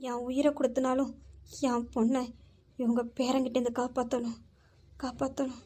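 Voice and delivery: quiet anguished vow. Acted. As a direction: vow to yourself under your breath.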